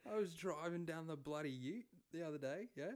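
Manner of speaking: put-on improvised Aussie yarn in accent; Australian accent. Co-host shows off his accent to host, Australian accent. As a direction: tell it as an Australian, ending in a rising question. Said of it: "That's really good".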